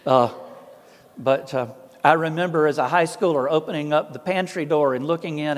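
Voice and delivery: usual speaking voice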